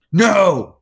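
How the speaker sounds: high, loud angry shout of "No"; retold outburst, pitched high; freaking out. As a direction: yell it like a man losing it. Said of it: "he starts freaking out".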